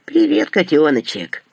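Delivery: lisping greeting, s turned to sh; slushy sh for s. Acted. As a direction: say «Привет, котёночек!» with a heavy lisp, s as sh. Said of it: "изрядно шепелявя".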